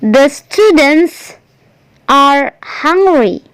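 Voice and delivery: "The students are hungry" read word by word, very slowly; careful classroom dictation pace; slow model reading. Shown as a demonstration. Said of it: "Perhatikan contoh yang keempat".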